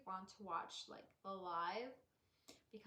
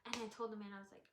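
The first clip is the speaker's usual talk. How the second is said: ordinary delivery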